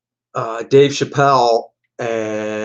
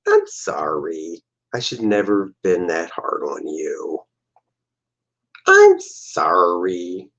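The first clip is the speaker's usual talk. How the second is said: sulky apology; 5 semitones lower, slower than usual. Acted. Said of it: "she's kinda sulky".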